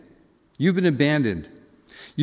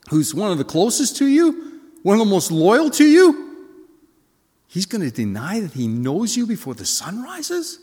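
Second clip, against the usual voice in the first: bewildered disciples; 3 semitones higher than usual. Acted. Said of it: "his disciples are all thinking".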